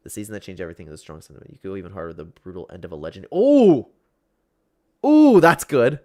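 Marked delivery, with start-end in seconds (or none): 3.3-6.0 excited. Real, not acted. Excited reaction to suggestion.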